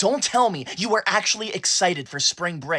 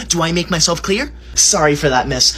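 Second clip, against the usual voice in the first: ordinary delivery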